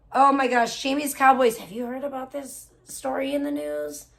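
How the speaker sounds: ordinary delivery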